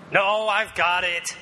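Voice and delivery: put-on a touch of a stubborn child; frustrated kid. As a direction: insist stubbornly you've got it. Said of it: "continuing frustrated".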